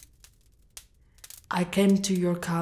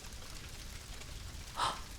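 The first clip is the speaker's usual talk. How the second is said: soft breathy gasp of relief; relieved gasp; 14 dB quieter than usual. Acted. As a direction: gasp as you spot her. Finding the hidden princess.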